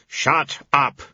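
curt. Acted. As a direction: cut him off flat out. Death silencing Famine.

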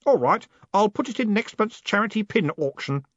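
put-on high, untroubled young voice; cheerful boy. A narrator's reading. Stanley planning for the pin auction, cheerful. Boy chats on about pins.

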